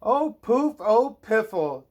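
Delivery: high, loud pouty princess huff; bored princess. Acted. Princess huffing at having nothing to do.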